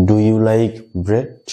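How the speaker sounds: very slow, bread with an open e; slow model sentence. Shown as a demonstration. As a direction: drill it slowly for the class. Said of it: "এইভাবে উচ্চারণটা তোমাদেরকে করতে হবে". (ধীরে ধীরে model উচ্চারণ)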